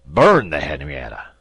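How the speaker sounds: loud shocked cry; appalled at the idea; indignant shout; outraged disbelief. A narrator's reading. Captain aghast at burning ship.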